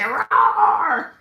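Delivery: loud playful roar; mock roar. Real, not acted. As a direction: roar out the frustration. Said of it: "that's what I wanna say".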